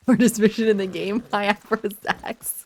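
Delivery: talking through laughter; laughing. Real, not acted. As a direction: laugh the words out. Laughing at the game.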